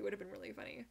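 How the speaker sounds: normal talking voice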